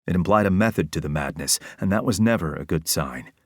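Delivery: plain reading voice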